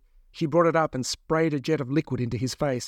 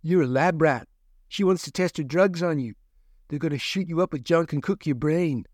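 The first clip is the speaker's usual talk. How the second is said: blunt, scornful captive man; bitter warning from a caged man; rough man voice; 3 semitones higher than usual. Acted.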